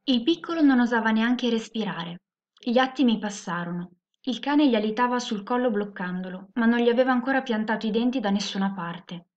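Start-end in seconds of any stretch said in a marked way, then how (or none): none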